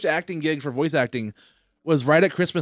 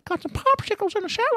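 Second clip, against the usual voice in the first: put-on high, thin old man croak; creepy old man falsetto; Herbert voice. Shown as a demonstration. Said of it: "Herbert the Pervert from Family Guy".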